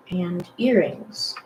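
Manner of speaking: usual speaking voice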